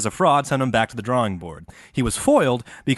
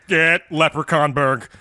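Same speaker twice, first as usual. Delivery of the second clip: slow, put-on Irish accent; a bad Irish accent, drawn out; hammed-up fake Irish accent from a fraud pilgrim; 4 semitones higher than usual. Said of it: "using a fake Irish accent".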